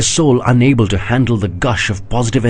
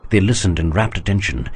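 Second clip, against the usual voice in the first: ordinary delivery, nothing marked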